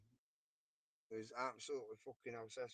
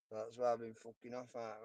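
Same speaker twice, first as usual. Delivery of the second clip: ordinary talk, nothing marked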